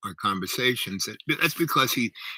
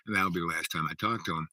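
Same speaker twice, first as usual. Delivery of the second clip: ordinary delivery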